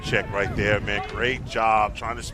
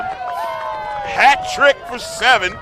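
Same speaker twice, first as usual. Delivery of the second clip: excited; 12 semitones higher, 8 dB louder, much slower than usual. Real, not acted. Announcer calls the hat trick.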